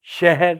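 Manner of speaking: شہر said as shehr, vowel leaned; شہر, the Urdu reading; correct pronunciation. Shown as a demonstration. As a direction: say شہر as shehr, not shahar. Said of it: "شہر نہیں".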